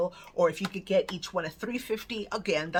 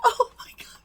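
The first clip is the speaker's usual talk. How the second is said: much higher and louder, half crying with laughter; laughing disbelief; 5 dB louder than usual. Real, not acted. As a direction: squeal through delighted laughter. Overjoyed at the gift.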